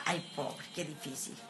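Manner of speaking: ordinary talk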